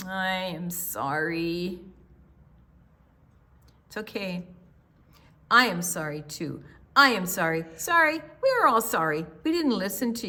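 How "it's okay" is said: ordinary delivery, nothing marked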